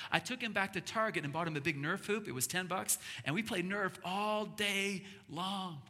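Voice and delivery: normal talking voice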